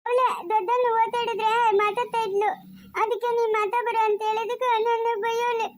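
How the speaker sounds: high wailing tattle; crying complaint. Acted. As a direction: sob out a hurt complaint. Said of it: "ಯಾಕೆ ಅಳ್ತಾ ಇರೋದು". (ಅಳುತ್ತಾ ದೂರು ಹೇಳುವುದು)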